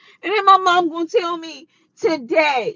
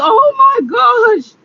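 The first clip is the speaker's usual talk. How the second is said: dismayed wail; 9 semitones higher, 6 dB louder than usual. Retold or imitated. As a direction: cry out in dismay. Upset retelling her reaction.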